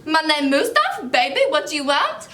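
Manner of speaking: high, swooping put-on character voice; Mustafa Al-Zakari. Girl replying to the caller in character, put-on voice. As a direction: insist on the made-up identity, comically. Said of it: "And I was like".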